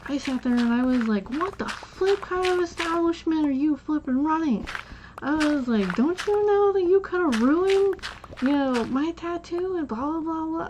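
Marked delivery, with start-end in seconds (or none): 1.3-4.7 indignant telling-off, pitched high; high-pitched, affronted mock rant; retold chewing-out. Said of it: "I flippin' chewed him out". 6.0-10.7 high, indignant scolding trailing into blahs; high-pitched mock tirade, easing off at the end; retold chewing-out. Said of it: "I flippin' chewed him out".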